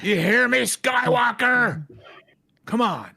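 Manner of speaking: hammed-up villain taunt. Taunting Luke from across the ship, hammed-up villain shout. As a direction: call out to Skywalker like a mock Sith.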